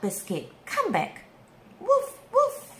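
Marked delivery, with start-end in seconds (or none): 1.8-2.7 high, playful puppy woofs; yappy little dog voice; puppy barks. Acted. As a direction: bark like a small happy puppy. Storyteller voices the puppy Biscuit.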